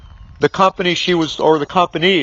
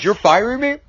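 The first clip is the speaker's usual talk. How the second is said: incredulous question, pitched up; shocked disbelief; 6 semitones higher than usual. Retold or imitated. Fired salesman questions his boss in shock, disbelieving. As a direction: ask it higher, as if you can't believe it. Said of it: "He was in a state of shock".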